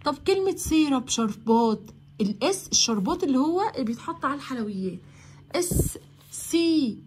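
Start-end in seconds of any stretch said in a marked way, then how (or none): none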